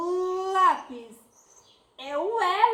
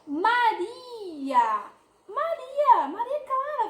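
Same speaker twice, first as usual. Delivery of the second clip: bright raised call; 7 semitones higher, 4 dB louder, much slower than usual. Shown as a demonstration. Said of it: "teacher calling child's name".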